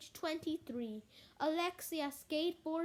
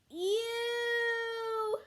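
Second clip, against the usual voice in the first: disgusted ew; 8 semitones higher, 5 dB louder than usual. Acted. Kids see a hairy spider.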